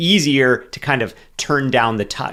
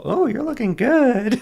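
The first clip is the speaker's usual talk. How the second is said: high falsetto imitation of wife; squeaky high mock female voice; imitating partner's voice; 12 semitones higher than usual. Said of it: "Is that my voice?".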